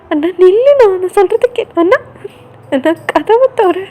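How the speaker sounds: high, loud pleading cry; desperate calling. Acted. Sister crying after her brother as he shuts the door, loud and desperate. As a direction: cry out for him to stop.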